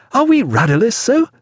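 high, appalled question; aghast, rising sharply in pitch. A narrator's reading. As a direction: ask it high, in horrified disbelief. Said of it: "cried Stephen, aghast".

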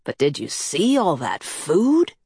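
swooping tune rising into the question; eager Coyote. A narrator's reading. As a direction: marvel at all that food. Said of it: "Coyote said".

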